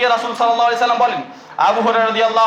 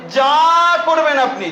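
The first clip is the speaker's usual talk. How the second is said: usual speaking voice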